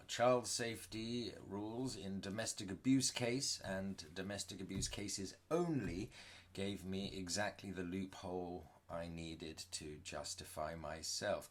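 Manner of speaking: usual speaking voice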